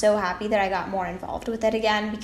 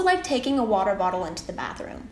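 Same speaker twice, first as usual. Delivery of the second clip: ordinary talk, nothing marked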